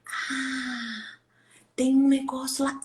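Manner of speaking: breathy whisper, then half voice; conspiratorial whisper to distract; whispering to a child. Retold or imitated. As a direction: hush the child with a secret.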